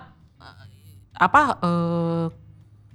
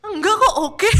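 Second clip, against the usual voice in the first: high-pitched, giggly denial; playful acted-out "I'm fine"; laughing mock reassurance; 11 semitones higher than usual. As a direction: wave it off in a high voice, breaking into laughter. Acts out reassuring a worried texter.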